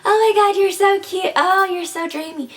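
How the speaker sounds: mock a love-struck rival gushing; gushing fan girl. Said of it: "they're probably going to be like".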